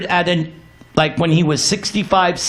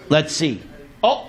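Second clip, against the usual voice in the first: ordinary talk, nothing marked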